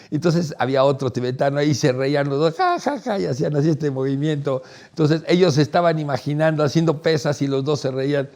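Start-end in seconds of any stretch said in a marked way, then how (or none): 2.5-3.2 high giggling imitation of the monks; high mock laugh. Monks laughing at the young man, high giggle. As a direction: mimic their high amused chuckle.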